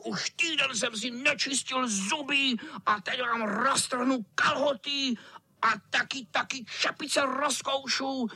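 high, menacing cat squeal; hammed-up wicked cat; threatening tomcat. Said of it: "vyhrožoval kocour".

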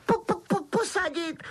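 very high stuttering wail; Dobby wailing. A narrator's reading. Elf overwhelmed by offer of a seat, wailing. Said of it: "kvílel".

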